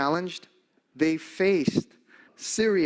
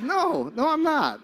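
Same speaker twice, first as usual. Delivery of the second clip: high emphatic denial; 13 semitones higher, 4 dB louder than usual. Retold or imitated. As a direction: pitch jumps way up, louder, denying. Denying being a priest.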